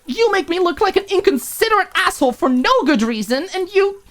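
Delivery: very high, loud, breaking off; fury catching in the throat; angry roommate choking on words; choked anger. Acted. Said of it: "Maud choked on her words".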